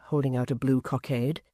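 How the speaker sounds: usual speaking voice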